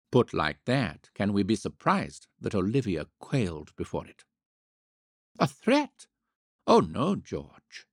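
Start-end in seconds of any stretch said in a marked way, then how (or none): 5.4-7.9 high, astonished denial; mock surprise. A narrator's reading. Olivia feigning astonishment at George's question, high and surprised. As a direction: play innocent shock.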